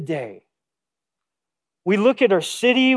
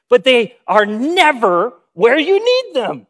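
ordinary talk, nothing marked

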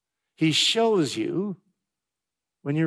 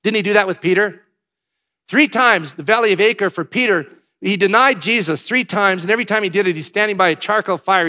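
loud and high, driving the story of Peter; preaching at full force; 4 semitones higher, 7 dB louder than usual. Real, not acted. Preacher to congregation about Peter, preached loud and high. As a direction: hammer it home like a revival preacher.

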